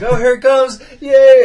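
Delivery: high, loud, gleeful shout; a hammed-up cry of delight; mock ecstatic yell. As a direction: yell it like he's about to climax. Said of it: "he's like".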